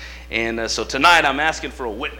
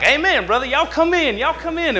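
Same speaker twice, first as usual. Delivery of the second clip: high, loud, cheerful welcome at the door; welcoming church greeter; 11 semitones higher, 3 dB louder than usual. Retold or imitated. Deacon at the door welcoming the bus crowd, high and loud. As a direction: call them in like the deacon at the door.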